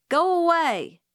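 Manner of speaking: high, loud sulky yell; girl shouting at her uncle to leave; angry shout. A narrator's reading. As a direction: shout him off through the door. Scout rejects Uncle Jack at door.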